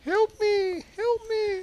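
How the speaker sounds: high, soft put-on voice; mock plea for help. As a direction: plead in a tiny high voice, jokingly. Mimics locked-out caller pleading.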